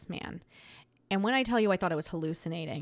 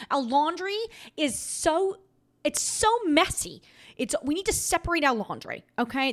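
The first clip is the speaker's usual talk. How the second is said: higher and louder, half laughing; exasperated; 7 semitones higher, 5 dB louder than usual. Real, not acted. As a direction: throw your hands up over the mess. Exasperated rant about relationship.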